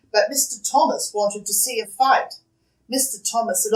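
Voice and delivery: usual speaking voice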